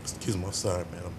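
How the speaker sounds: tearful apology through real crying; fighting back real tears while apologizing; choking up. Real, not acted. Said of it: "I'm sorry for getting emotional".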